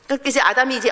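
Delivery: ordinary talk, nothing marked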